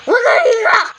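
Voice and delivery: high, sudden acted yell; scolding shout. Older sibling ordering baby not to cry, scolding shout.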